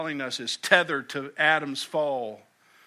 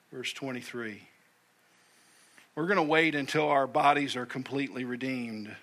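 ordinary talk

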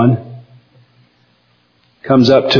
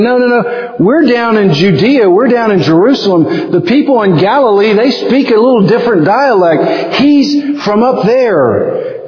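higher, insistent crowd voice; bystanders accusing; 7 semitones higher than usual. Retold or imitated. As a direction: point out the outsider's dialect. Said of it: "they're saying".

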